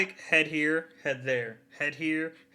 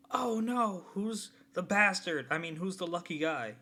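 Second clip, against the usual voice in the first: high, slow mock alarm as grandpa; hammed-up protective grandpa; mock shocked grandpa; 7 semitones higher, 4 dB quieter, slower than usual. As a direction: gasp it out like a startled old man. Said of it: "he's like".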